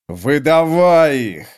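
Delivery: slow, loud growl from horseback; growled order. A narrator's reading. The sergeant demands the prisoners from horseback, slow loud growl. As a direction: growl it down at him slowly. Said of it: "зарычал с коня сгорбившийся сержант".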